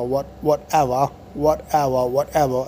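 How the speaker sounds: put-on whatever, whatever, whatever, tossed off like a bored teen; teen slang whatever. Shown as a demonstration. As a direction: repeat whatever like slang you can't stop. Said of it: "in its place is a like".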